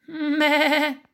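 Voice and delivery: high, quavering goat bleat; belato di capra. A narrator's reading. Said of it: "bela la capra". (belato acuto e tremolante)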